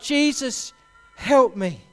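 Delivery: loud, high cry for help. Retold or imitated. Father crying out to Jesus for his son, loud desperate cry. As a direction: call out to Jesus in desperation, full voice. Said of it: "And he cried out".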